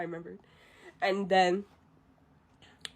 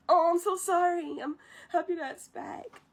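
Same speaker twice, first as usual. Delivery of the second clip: Mushu voice; 9 semitones higher than usual. Retold or imitated.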